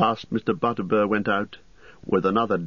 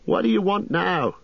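high, put-out innkeeper voice; vexed landlord, pitched well up; exasperated cry; 12 semitones higher, 3 dB louder than usual. A narrator's reading. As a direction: exclaim in annoyance at an unwelcome visitor. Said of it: "he cried".

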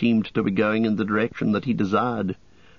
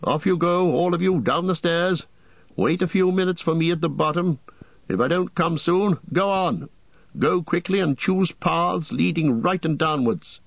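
higher, urgent wizard orders; urgent Gandalf; 7 semitones higher than usual. Acted. Gandalf ordering the company away, higher urgent voice. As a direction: hurry them down the stairs.

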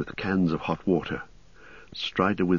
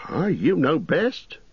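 knowing; 11 semitones higher, slower than usual. Acted.

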